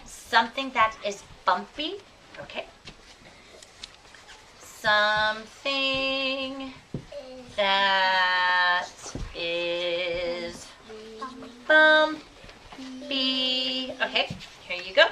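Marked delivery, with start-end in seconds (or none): 4.6-13.9 each word drawn out slowly; slow teacher dictation. Shown as a demonstration. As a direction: spell it out word by word for the board. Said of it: "teacher writes and dictates slowly".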